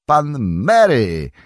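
loud, high and slow astonishment; surprised cry. Acted. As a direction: call out in amazed relief. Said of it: "krzyknął Magot". (zdumiony, głośny okrzyk)

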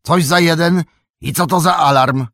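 high, loud demanding question; shouted challenge. A narrator's reading. Farmer challenges the stranger about the alarm, shouting. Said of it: "krzyknął".

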